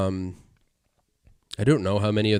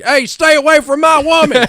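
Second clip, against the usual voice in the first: much higher, loud cowboy growl; old Western voice; 11 dB louder than usual. Retold or imitated. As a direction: bark it like a Western tough guy. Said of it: "like an old Western".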